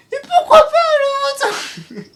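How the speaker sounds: very high, loud squeaky impression; piercing little falsetto cry; Millie. Shown as a demonstration. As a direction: shriek it slowly like a tiny character. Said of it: "je sais faire Millie".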